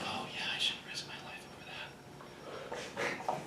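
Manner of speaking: fast, breathy sarcastic whisper. Retold or imitated. A viewer mocking the ad with a sarcastic whisper. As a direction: scoff it under your breath, fast. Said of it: "and you're like".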